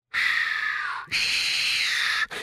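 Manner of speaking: long voiceless "shhh" hiss; sharp hushing hiss. A narrator's reading. Gollum hushes the resting hobbits, hissing. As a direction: hiss a drawn-out shush. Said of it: "шипел Горлум".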